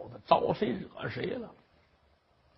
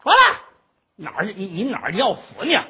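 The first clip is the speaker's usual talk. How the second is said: put-on shrill yelling old mother; 3 semitones higher, 8 dB louder, faster than usual. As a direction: yell her back into the room.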